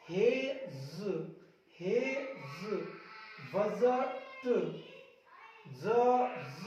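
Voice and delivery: long drawn vowel, detached final consonant; sounded-out reading. Shown as a demonstration. Teacher demonstrating silent D words, slowly sounded out. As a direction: read each word slowly, releasing the ending separately. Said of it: "देखो इसको क्या पढ़ेंगे".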